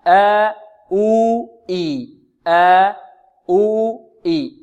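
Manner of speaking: آ، أو، إي held slowly as long vowels after a hamza; slow, drawn-out آ أو إي. Shown as a demonstration. Teacher demonstrates the vowel sounds of مد البدل. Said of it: "كل همز ممدود".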